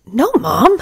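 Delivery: sharp, high-pitched denial; snapping. Acted. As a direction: snap back defensively. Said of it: "she snapped".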